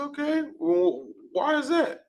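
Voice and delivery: high, slow, falsetto surprise; mock surprise. Teasing a brother about the fourth in a high falsetto. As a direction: squeak it out like mock disbelief. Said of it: "I said".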